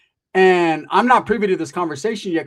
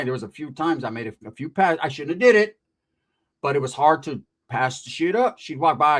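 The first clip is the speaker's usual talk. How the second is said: ordinary delivery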